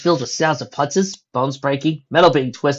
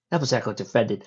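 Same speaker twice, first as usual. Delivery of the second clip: usual speaking voice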